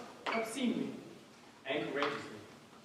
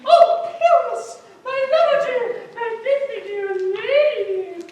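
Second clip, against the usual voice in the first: high, loud mock lady's voice; monstrous little voice; 12 semitones higher, 8 dB louder than usual. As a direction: squeak it out like a lovesick lady. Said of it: "I am speaking a monstrous little voice".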